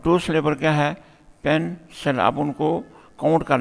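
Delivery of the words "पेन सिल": पेन, सिल with a break between the two syllables; split into syllables. Shown as a demonstration. Teacher counting the syllables of a word, split into syllables. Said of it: "आवाज़ के ज़रिए तकसीम".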